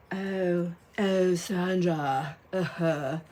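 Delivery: slow, stretched "oh, Sandra"; lightly voiced gushing lady; mock drawn-out greeting. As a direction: drag it out like a gushing churchgoer. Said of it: "Just kidding. She didn't say it like that".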